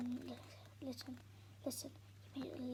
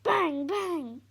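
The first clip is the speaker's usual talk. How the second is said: sharp, loud banging sounds; loud mimicked bangs; 5 semitones higher, 15 dB louder than usual. The narrator mimics Mum banging the chairs. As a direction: slam the words like chairs.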